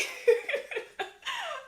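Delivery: high disbelieving laugh; helpless laugh. Real, not acted. At her own run of bad luck, helpless laugh. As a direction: laugh at how absurd it is.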